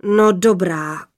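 slow, grudging giant father; slow, weary rumble of assent; sighing giant. A narrator's reading. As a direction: sigh it out as a fearsome father. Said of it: "vzdychl hrozitánský hlas".

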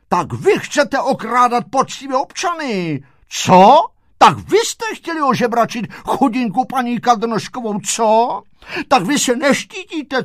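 high, loud accusations with barked tags; indignant shouting. A narrator's reading. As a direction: scold the thieves, punching each question. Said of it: "všichni volali". (rozhořčeně křičí, vysoko a hlasitě)

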